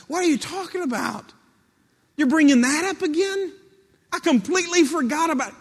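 high, loud astonished protest; acted amazement at old news; incredulous. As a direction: exclaim in sheer disbelief, pitch way up. God's astonished reply, acted.

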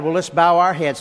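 ordinary delivery

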